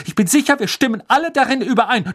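high loud haughty insistence; haughty Frenchwoman. Acted. Maxime voiced.

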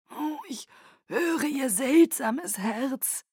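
melodic Aro. Acted. Aro marvels at Bella's heart, high and slow, nearly melodic. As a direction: stretch it out, almost singing. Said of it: "Stimme klang fast melodisch".

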